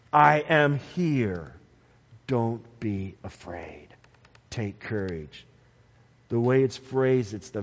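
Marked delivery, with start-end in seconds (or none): 0.1-5.5 low, soft and slow, gently reassuring; calm the frightened men with a hushed voice; Jesus gently soothing his terrified disciples; tender reassurance. Acted.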